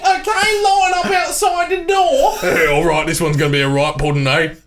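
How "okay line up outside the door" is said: high, rising hammed-up barker's patter; comic British barker. Rowdy bloke calling punters to line up outside, high comic shout. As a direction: call the queue in, stretching the last words high. Playing a fairground-style barker in bit.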